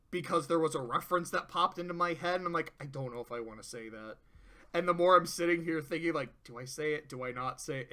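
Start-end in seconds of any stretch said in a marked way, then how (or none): 2.8-4.2 much softer and lower, quick; quick private hesitation; hushed inner doubt. Retold or imitated. Inner doubt voiced to self. 6.4-7.9 softer, lower, under the breath; low murmured dilemma; hushed self-questioning. Retold or imitated. Inner dilemma voiced to self.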